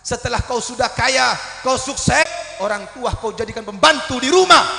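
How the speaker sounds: loud scolding preacher; stern rebuke. Acted. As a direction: scold them for shaming their parents. Rebuking the congregation. (teguran keras kepada jamaah)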